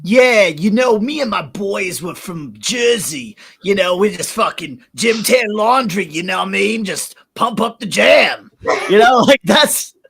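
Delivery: brash Jersey bro caricature, shouting and pumped up; Jersey guido impression. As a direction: ham it up like a Jersey Shore guy bragging about the gym. Mock Jersey gym bro bit.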